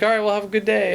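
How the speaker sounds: imitating the ranger. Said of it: "He's like".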